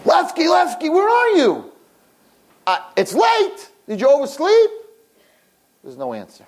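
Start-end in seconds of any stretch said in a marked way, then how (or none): 0.0-1.7 very high-pitched calling out; acted high yell; screaming across room. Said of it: "he starts screaming across the room". 2.7-4.7 high, strained calling voice; acted high-pitched holler; screaming across room. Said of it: "he starts screaming across the room".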